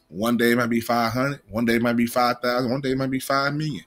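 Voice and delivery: usual speaking voice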